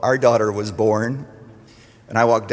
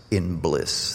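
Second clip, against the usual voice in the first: usual speaking voice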